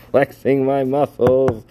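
words squeezed out high and loud through laughter, slowly; laughing while talking. Real, not acted. Host embarrassed by his flexing picture, laughing. Laughing about own photo.

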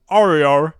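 "Oreo" said loud with a big British mouth. Shown as a demonstration. Host mimics the British translator's "Oreo", loud and mouthy. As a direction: work the mouth hard on "Oreo". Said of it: "da muss man ganz für den Mund arbeiten".

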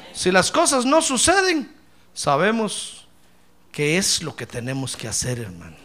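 normal talking voice

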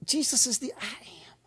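soft low whisper; reverent whisper. Real, not acted. Preacher whispering praise to the congregation. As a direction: hush it like a secret.

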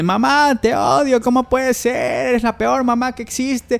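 put-on high-pitched outraged teen yelling at her mother; furious teen daughter. Grounded daughter accusing her mother, high and outraged. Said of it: "Ella porque".

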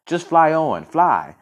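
high, loud urging yell. A narrator's reading. Quill urging the falcon onward, yelling. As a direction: call it out, pushing onward. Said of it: "he yelled".

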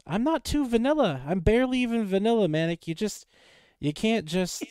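high, loud and fast; excited protest. Real, not acted. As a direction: protest it, wound up. Insisting he's not bland.

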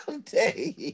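high, slow, laughing through it; mock outrage dissolving into laughter.

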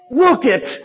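high, loud attention-grabbing call. Acted. Preacher acting out the meaning of behold, calling out. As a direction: call out sharply to make them look.

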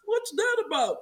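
high, fast, mock-confused question; high quick mock confusion; puzzled outsider. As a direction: play a baffled newcomer. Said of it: "be like".

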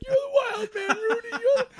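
very high, loud, tearful yelling; hammed-up sobbing cheer at the screen; overcome fan shouting. Fan shouting back at Rudy, high weepy yell. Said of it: "I'm like".

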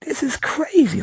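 high, slow puzzled voice; mock bafflement. Asking the fleeing drivers, high slow baffled voice. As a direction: ask it high and drawn out, baffled. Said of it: "you be like".

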